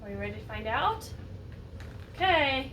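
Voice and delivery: usual speaking voice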